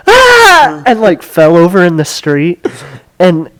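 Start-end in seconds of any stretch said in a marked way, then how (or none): 0.1-0.8 pained yelp. Retold or imitated. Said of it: "And he went".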